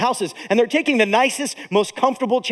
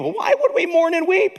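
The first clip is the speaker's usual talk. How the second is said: ordinary delivery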